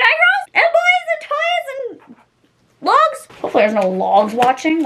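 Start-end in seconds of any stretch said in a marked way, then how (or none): 0.0-3.3 high squeaky sing-song to the viewers; goofy high call-out, drawn out; squeaky silly voice. Pretend. Vlogger teasing viewers in a squeaky high voice.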